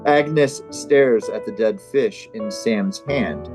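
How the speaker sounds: normal talking voice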